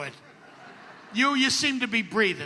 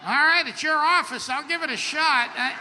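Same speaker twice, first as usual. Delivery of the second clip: louder, higher, cocky bluster at the doctor; mock-obliging rant; 5 semitones higher, 4 dB louder than usual. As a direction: ham up a brash patient who is game for anything.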